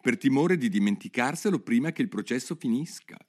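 ordinary delivery

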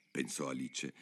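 usual speaking voice

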